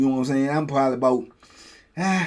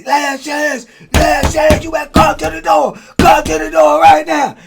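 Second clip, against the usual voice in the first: higher, louder barked order; guard's shout; 13 semitones higher, 8 dB louder than usual. Retold or imitated. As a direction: yell the last chance at him. Guards shouting final chance.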